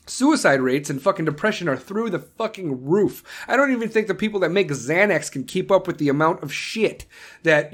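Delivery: ordinary talk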